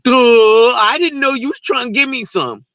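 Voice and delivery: clueless dumb self. Retold or imitated. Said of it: "my dumb ass ... was just like".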